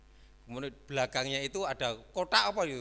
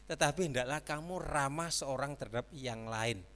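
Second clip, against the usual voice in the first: ordinary delivery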